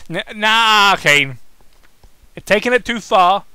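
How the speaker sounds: loud, clipped-off angry outburst; angry snap. Acted. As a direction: snap it back at him. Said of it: "Daniel snapped angrily".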